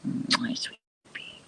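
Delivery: whispered cooing; whispering. Real, not acted. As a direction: breathe it to the pet. Unvoiced whisper.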